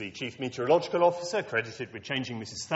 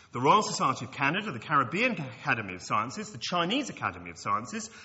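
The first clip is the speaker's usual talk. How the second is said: ordinary delivery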